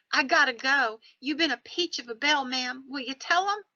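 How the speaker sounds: put-on high, urgent Southern boy's farewell; Southern accent. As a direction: imitate a young Southern soldier taking his leave. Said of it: "with his Southern accent in place".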